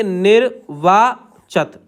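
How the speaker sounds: निर्वाचत stretched very slowly; very slow, syllable-by-syllable निर्वाचत; slow dictation. Shown as a demonstration. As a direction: draw out निर्वाचत while writing. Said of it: "निर्वाचित कैसे बनाएंगे".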